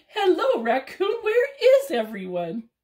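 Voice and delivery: very high, loud squirrel; a chirpy squirrel popping in. Acted. Squirrel greeting raccoon, high and loud.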